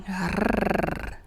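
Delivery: long rolled R like a purr; sustained trilled "rrrr". Shown as a demonstration. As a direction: purr with the tongue tip. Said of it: "It is kinda like purring".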